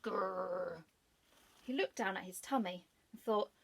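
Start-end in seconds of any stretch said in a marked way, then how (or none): 0.0-0.8 dog growling inside the fox's sack. Acted. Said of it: "he heard a growl".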